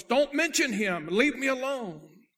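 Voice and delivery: loud, high, drawn-out shout; agitated demand. Retold or imitated. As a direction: cry out slowly and forcefully to be left in peace. Possessed boy cuts the preacher off.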